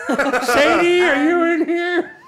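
put-on high, quavering old man calling out; a lost old man wandering the brothel halls calling for Sadie. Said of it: "Wandering old man".

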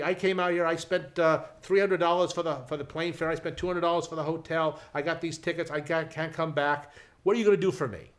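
man yelling about his ruined trip; angry yelling. Retold or imitated. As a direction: let the furious tourist rip at the usher. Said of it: "he is yelling and screaming".

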